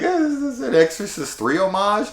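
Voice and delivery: high-pitched puzzled question; mock incredulous. Viewer retelling a reaction to the kill, incredulous. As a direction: voice shoots up in comic doubt. Acts own reaction to scene.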